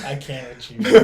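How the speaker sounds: loud, real laughter in the voice; cracking up at a friend; laughing. Real, not acted. Cracking up at co-host.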